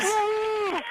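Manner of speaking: high joyful whoop; excited whoop. Real, not acted. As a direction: whoop with delight. Cheering at the images.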